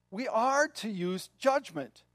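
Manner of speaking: usual speaking voice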